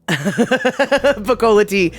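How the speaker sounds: slow, laughing through the words. Real, not acted. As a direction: chuckle it out slowly. Laughing while reading a tie name.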